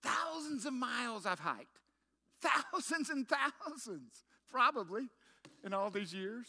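high, loud, slow pulpit build; a preacher's full-voiced testimony; fiery preaching. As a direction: preach it out to the whole congregation. Preaching climax about hiking stick.

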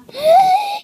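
high startled gasp; sharp frightened gasp. Pretend. Spots a monster.

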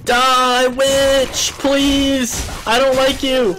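high, loud pleading yell; desperate shouting. Real, not acted. Pleading while fighting a witch.

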